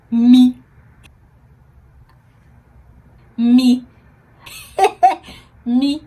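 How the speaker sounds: can't believe it's her; incredulous me. Retold or imitated. Told she needs daily injections.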